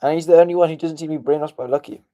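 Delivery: ordinary delivery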